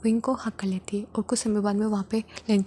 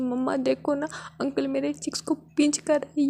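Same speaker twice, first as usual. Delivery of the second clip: put-on higher, whining little girl complaining; a small child telling on her uncle to mom; high whiny child voice; 5 semitones higher than usual. A narrator's reading. (ऊँची, शिकायती बच्ची की आवाज़)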